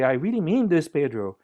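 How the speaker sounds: mean it, hit every word; with emphasis. Shown as a demonstration. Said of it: "you can put the emphasis".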